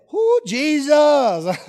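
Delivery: loud, high, drawn-out holy shout; revival whoop. Acted. Peter greeting Jesus from the boat, revival whoop. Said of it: "trying to start a revival".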